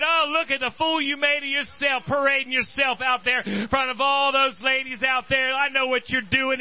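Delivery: high scornful mock shout at her husband; mocking wife. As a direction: sneer at him like a jealous wife. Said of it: "Didn't his wife said".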